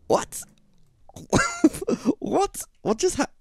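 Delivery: high stunned what; baffled. Real, not acted. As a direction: squeak in disbelief. Wheel exploded.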